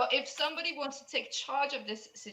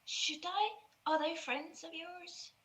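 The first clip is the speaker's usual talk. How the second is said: higher and soft, unsure; timid girl; 7 semitones higher, 7 dB quieter, slower than usual. Acted. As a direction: ask shyly, a little lost. GM voices the girl.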